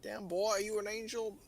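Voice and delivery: a high, hammed-up bad American accent. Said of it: "the worst American accent".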